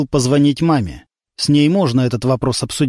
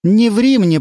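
firm, higher mother's demand; stern mother; 7 semitones higher, 3 dB louder, slower than usual. A narrator's reading. Mother goes on the attack at her son, stern. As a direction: press him hard. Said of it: "пошла в наступление мама".

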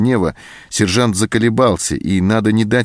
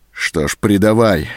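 plain reading voice

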